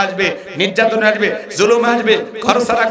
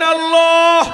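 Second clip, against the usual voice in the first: full shout; 9 semitones higher, much slower than usual. Shown as a demonstration. Preacher leading the crowd in a shouted call. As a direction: call out "Allah" with full force. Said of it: "আর একবার বলেন".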